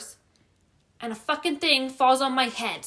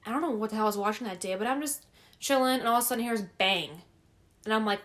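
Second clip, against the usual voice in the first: normal talking voice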